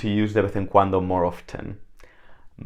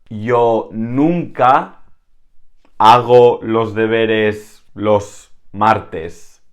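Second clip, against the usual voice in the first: slow and clear, a bit louder, every word spaced; slow model sentence; 4 dB louder, much slower than usual. Shown as a demonstration. Teacher reading an example sentence slowly, clear. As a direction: read the example slowly for learners. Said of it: "slow model sentence".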